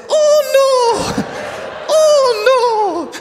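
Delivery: high, loud Scottish cry broken by laughs; laughing Scottish panic. Retold or imitated. Stu at the height of the flood, high laughing Scottish yell. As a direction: shriek it in Scottish while cracking up. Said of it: "he was like this".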